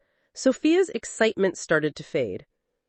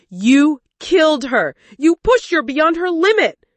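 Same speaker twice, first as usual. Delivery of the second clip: furious accusation; 9 semitones higher, 7 dB louder than usual. Acted. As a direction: hurl the blame at him. Husband blames the lover.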